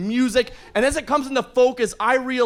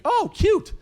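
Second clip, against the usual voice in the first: cooing bouncer; 11 semitones higher than usual. Retold or imitated. Bouncer flirts cutely.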